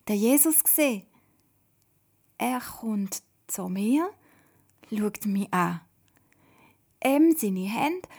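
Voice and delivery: slow, wondering account in short phrases; blissful. A narrator's reading. Toda recounting her meeting with Jesus, blissful. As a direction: savour each image with rising delight. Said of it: "aber man hört sie ihrer Stimme an".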